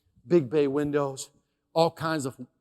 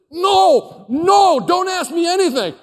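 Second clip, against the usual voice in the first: high, loud panicked shout of no; inner scream; 14 semitones higher, 13 dB louder, slower than usual. Retold or imitated. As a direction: shriek it like your mind is begging him to stop. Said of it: "And inside, I'm doing this".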